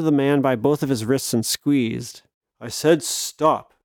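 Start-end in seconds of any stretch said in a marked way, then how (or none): 2.6-3.7 slow, firm warning; firm command. Acted. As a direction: lay down the order slowly. Hero orders the villain.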